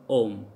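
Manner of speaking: "om" said with no H at the start; silent H. Shown as a demonstration. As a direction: begin "om" straight on the vowel. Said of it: "Because H is silent here".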